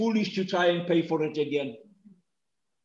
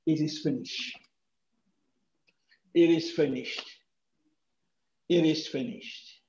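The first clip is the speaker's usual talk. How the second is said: hushed last words; 4 semitones lower, 6 dB quieter than usual. Acted. Quiet dying words of Jesus.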